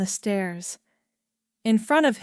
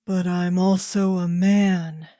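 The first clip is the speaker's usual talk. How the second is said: ordinary delivery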